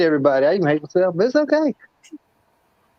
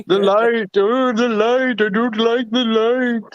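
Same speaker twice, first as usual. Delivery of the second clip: slow, strained vampire recoiling from light; slow hammed-up vampire wail; vampire in sunlight; 3 semitones higher, slower than usual. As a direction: moan about the light like a burning vampire. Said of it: "if she was a vampire".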